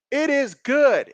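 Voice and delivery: slow, rising call of the good kick; excited call. Real, not acted. Live call of field goal.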